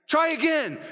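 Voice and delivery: high, emphatic urging; urging shout. Acted. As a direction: call it out to spur them on. Urging fallen dog to climb.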